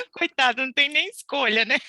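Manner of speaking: high, loud laughing pity; real laughter, mock sympathy. Real, not acted. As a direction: laugh while feeling sorry for him. Laughing pity for her son.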